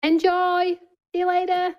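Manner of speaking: high, sing-out farewell; calling out goodbye. Real, not acted. Calling to someone on their way out, high singsong goodbye. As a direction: call it across, pitched well up.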